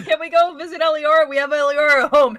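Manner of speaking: mock child asking a parent; mocking quote. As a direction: parody the meme line. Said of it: "It's like, we".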